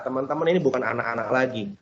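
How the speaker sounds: normal talking voice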